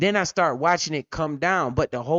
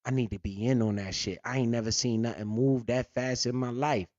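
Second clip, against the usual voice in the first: quiet awe; 4 semitones lower, 6 dB quieter than usual. Retold or imitated. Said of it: "I was like".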